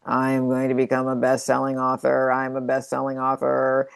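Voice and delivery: low, droning monotone like a zombie; zombie affirmation. Shown as a demonstration. Coach mocks rote affirmations, zombie monotone. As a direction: drone it out bored and lifeless, all on one note. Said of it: "like the zombie affirmation".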